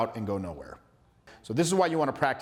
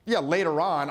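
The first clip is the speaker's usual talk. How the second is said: ordinary delivery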